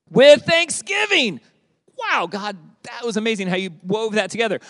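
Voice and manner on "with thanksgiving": high, slow stress on with thanksgiving; drawn-out emphasis. Acted. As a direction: lift and stretch "with thanksgiving". Scripture read with emphasis.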